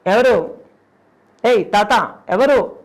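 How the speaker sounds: loud repeated calls; shouting. Retold or imitated. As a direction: call out to the stranger. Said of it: "అని అరుస్తున్నా". (గట్టిగా పిలుపు)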